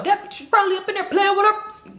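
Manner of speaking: high-pitched imitated holler; screamed out. Joe screams out an accusation, imitated high holler. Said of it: "That's what Joe screamed out".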